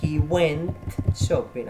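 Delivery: slow, with went stressed; slow model answer. Shown as a demonstration. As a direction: lay out the answer for learners. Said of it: "¿Cómo digo él fue?".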